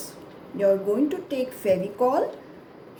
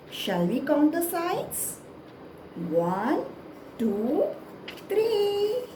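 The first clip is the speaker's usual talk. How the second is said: put-on broad singsong kids theatre; 7 semitones higher, much slower than usual. Counting with children.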